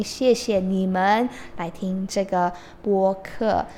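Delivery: ordinary talk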